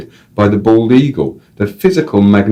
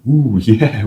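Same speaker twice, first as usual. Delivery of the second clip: impressed ooh; 4 semitones higher than usual. Retold or imitated. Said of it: "we said".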